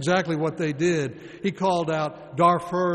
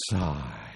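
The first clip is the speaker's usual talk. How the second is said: sighed word; 11 semitones lower than usual. Acted. Demonstrating the communal sigh.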